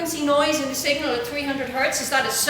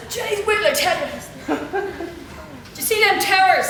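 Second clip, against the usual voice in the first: higher, louder Irish lad accent, mildly imitated; a hint of a thick Irish lad, loud and eager; Irish lad impression; 7 semitones higher, 4 dB louder than usual. Irish lad at the campsite bragging to her, in an Irish accent. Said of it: "And he says".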